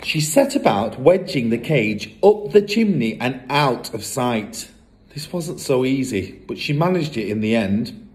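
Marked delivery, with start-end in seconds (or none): none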